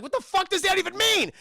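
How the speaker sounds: high, loud, outraged yelling; furious outburst. Retold or imitated. Villain rages at his men after the taunt, furious. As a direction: blow up at the henchmen in fury. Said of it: "He's so pissed off about it".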